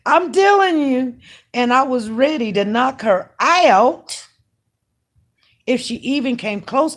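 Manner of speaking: loud, high, animated exclamation; worked-up emphasis. Real, not acted. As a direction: holler it fresh out of a laugh. Worked up retelling a near fight.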